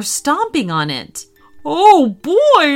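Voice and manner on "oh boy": loud, high, gleeful little dinosaur; happy high creature cry; cheery dinosaur. Acted. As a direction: squeal with delight. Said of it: "said the dinosaur cheerily".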